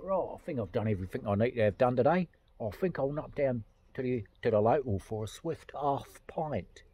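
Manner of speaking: mock London accent; English accent. Englishman heading to the local, mock London accent. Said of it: "they all talk like that".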